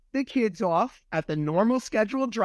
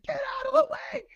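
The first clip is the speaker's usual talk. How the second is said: high shrill mock yell at the child; mock scream. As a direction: shriek it like a panicked parent. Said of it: "started screaming at the car".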